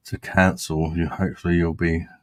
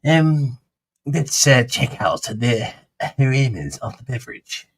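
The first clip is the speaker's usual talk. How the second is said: posh voice; 4 semitones higher than usual. Pretend. Posh tasting notes on the rosé for the camera. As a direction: sniff and savour like a snooty sommelier. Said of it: "Sounds rather posh".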